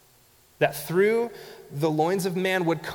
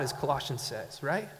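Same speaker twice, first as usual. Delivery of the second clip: ordinary delivery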